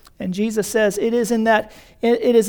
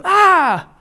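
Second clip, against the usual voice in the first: a sudden high short yelp of mock rage; mock angry outburst; 7 semitones higher, 4 dB louder than usual. Pastor imitating a congregant yelling at him, mock outburst. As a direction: fire off a brief hammed-up angry cry. His own mirrored angry reply.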